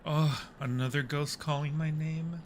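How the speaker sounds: ordinary talk, nothing marked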